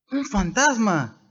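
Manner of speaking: high, slow, startled gasp of fear; mild frightened shout; frightened cry. A narrator's reading. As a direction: cry it out as terrified disciples seeing a ghost. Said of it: "Y dieron voces de miedo".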